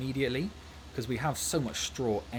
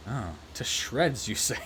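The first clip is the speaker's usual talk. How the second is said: slow, drawn-out mock surprise on the repeated line; slow deadpan repeat; slower than usual. Professor hearing about the wife, slow deadpan repeat. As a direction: stretch the punchline slowly, half-puzzled. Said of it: "he's just like".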